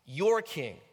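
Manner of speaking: "Your" punched loud, then falling; stress on YOUR. Shown as a demonstration. Preacher emphasizing different words, stress on "Your". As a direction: hit the word "Your" hard. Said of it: "Emphasis on your".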